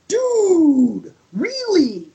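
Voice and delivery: high, drawn-out disbelief; amazed gushing. Real, not acted. Fan gushing about the tag team's promos, amazed. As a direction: stretch it high, then let it fall in amazement.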